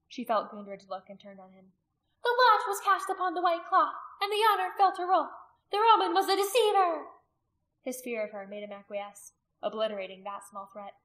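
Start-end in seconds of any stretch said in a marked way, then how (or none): 2.2-7.1 high, loud, insistent declaration; domineering priestess. A narrator's reading. Priestess justifying herself to the warriors, loud and insistent. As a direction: proclaim it forcefully in a high voice.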